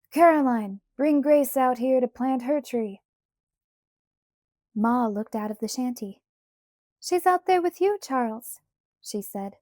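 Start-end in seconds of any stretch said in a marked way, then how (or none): 0.1-3.0 raised voice, calling across the yard. A narrator's reading. Pa calls to Ma to bring Grace out, calling out. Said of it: "He called to Ma". 7.0-8.6 high, louder reply called from the doorway; calling back. A narrator's reading. Ma answers Pa from the shanty, calling back.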